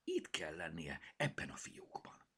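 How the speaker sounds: quiet searching murmur; muttering to herself. Acted. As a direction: keep murmuring as you search. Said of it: "dünnyögött magában".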